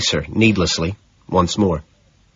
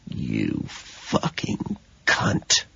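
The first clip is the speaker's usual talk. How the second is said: slow, low, breathless rasped whisper; heavy raspy whisper; 11 semitones lower, 11 dB quieter, much slower than usual. Acted. Killer leaning to her ear, slow raspy whisper. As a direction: drool it right into her ear, barely voiced. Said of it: "my voice drops away to a heavy, raspy whisper".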